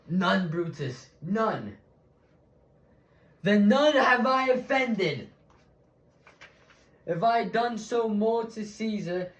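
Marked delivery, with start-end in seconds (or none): 3.4-5.3 forceful stage oratory; emphatic declamation. Acted. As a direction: ring out the conclusion to the crowd. Orator's triumphant answer to silent crowd.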